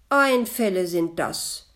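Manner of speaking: loud reproachful greeting; loud hurt reproach. A narrator's reading. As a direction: scold him for gossiping. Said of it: "begrüßte es den Metzger vorwurfsvoll".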